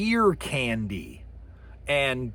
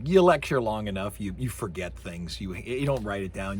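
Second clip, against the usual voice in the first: ordinary talk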